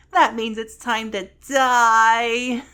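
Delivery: slow, loud announcement of doom; mock doom. Narrator declaring verb talk fatal, mock doom. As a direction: draw out the last word loudly.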